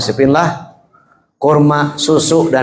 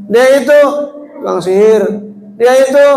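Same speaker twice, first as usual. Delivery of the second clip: blind heckler; 7 semitones higher, faster than usual. Acted. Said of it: "teriak-teriak".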